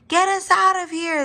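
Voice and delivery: high-pitched group cry for rescue; yelling. A narrator's reading. Dinosaur family stuck in mud, all yelling. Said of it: "they all yelled".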